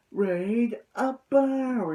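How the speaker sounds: mock anime move shout; drawn-out battle call. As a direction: draw out the attack call.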